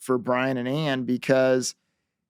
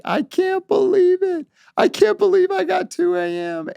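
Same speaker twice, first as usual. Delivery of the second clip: very high squeal of disbelief; excited. Retold or imitated. Teen gushing to her friend on the phone, high and thrilled. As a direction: gush like a thrilled teenager. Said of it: "she was so excited".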